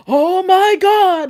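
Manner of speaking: drawn-out, loud and slow "Oh my God"; Fluff's catchphrase. Retold or imitated. Teasing the chat by imitating Fluff's drawn-out loud "Oh my God". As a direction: stretch it out loud like a stunned streamer. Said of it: "like you do".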